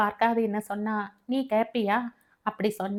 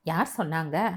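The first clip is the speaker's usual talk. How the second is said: lower, different fatherly voice; father asking; 5 semitones lower than usual. Acted. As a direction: ask it low as the dad. Father asks who said it. (தாழ்ந்த குரலில் அப்பாவின் கேள்வி)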